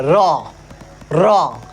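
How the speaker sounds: र said clearly as a retroflex sound; demo of र. Shown as a demonstration. Teacher demonstrating र to the class. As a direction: show the students र. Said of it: "हम र बोल रहे हैं".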